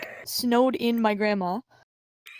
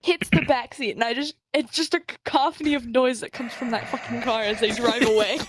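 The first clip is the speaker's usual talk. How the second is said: ordinary talk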